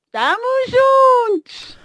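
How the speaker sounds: high, drawn-out rallying cry; real celebratory cheer. Real, not acted. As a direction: cheer it out high and stretched, showing support. Ouvinte festeja os Tubarões Azuis. (grito de apoio festivo, agudo e arrastado)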